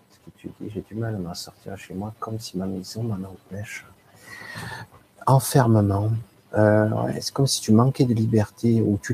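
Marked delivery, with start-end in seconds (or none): 0.1-3.9 soft, murmured reading aloud; reading the question quietly. Real, not acted. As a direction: read the question back under your breath. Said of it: "je vais relire ta question". (relecture de la question à voix basse)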